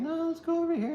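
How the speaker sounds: very high, squeaky mini-figure voice; a tiny version of him squeaks his way; squeaky little voice. Retold or imitated. Little chariot puller squeaking to go over here. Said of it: "this guy's like".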